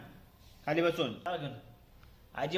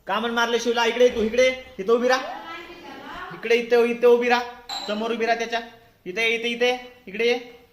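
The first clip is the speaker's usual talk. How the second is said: loud, raised-pitch parental telling-off; real scolding, voice raised high; stern scolding; 5 semitones higher, 4 dB louder than usual. Real, not acted. Parent scolds child for hitting.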